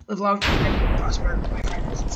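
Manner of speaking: hammed-up Vulcan salute; mock solemn farewell. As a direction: say it like a ceremonial salute.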